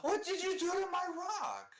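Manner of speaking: high, laughing mock outrage. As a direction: squeal it out through a laugh. Player's rock thrown into woods.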